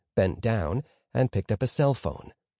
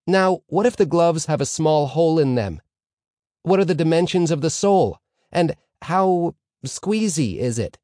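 higher, louder pupil pressing a question; questioning pupil Moshe Tzvi; 7 semitones higher, 5 dB louder than usual. A narrator's reading. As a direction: pipe up with a query in class.

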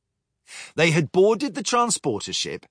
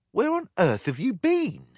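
usual speaking voice

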